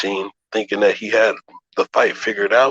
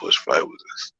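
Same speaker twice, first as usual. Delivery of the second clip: soft self-directed whisper; whispered to himself; 7 semitones lower, 4 dB quieter than usual. Real, not acted. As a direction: lower and voiceless, muttered aside.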